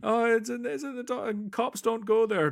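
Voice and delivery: high-pitched, babbling cop voice; frightened cop. Acted. Corrupt cop making excuses to Batman, high panicked voice. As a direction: whimper excuses in a put-on high voice. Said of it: "it's like".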